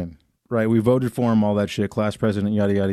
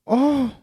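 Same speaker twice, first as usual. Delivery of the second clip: mock shocked gasp; 12 semitones higher than usual. Insulted girl reacting to his comeback, mock shocked gasp. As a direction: act out being scandalized with a gasp. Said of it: "the look on her face was like".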